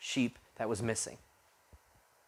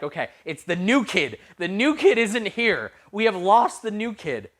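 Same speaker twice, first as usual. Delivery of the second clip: acted panic, higher and rising in pitch; retold panic; 6 semitones higher, 3 dB louder than usual. Leader realizing the new kid is lost, acted panic. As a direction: retell it with mounting alarm, stretching the words. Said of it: "I'm panicking".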